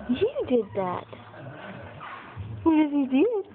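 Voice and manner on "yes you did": ordinary talk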